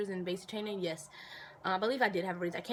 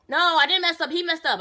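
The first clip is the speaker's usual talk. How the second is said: much louder, higher denial; loud protest; 10 semitones higher, 12 dB louder than usual. Real, not acted. Answering soldiers who blamed her, loud high protest. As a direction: fire back the denial hard. Defending herself against soldiers' gossip.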